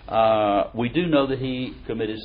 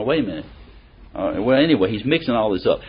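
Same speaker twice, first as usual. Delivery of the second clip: ordinary talk, nothing marked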